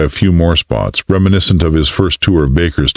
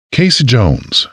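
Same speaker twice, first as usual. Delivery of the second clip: Southern drawl; 8 semitones higher, slower than usual. A narrator's reading. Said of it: "in her southern drawl".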